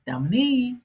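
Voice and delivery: a hint of grandma calling down the hall; grandmother calling name. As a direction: call the name out like grandma from the next room. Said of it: "in my grandmother's voice".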